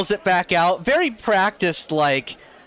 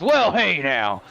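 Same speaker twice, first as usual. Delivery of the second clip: offended. Acted. Kaltog to Frankie defending his gun, a mild offended huff. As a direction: puff up at the insult. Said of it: "looks over offended".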